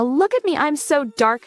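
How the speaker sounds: high-pitched, mocking show-off; mock dark villain. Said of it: "type of villain".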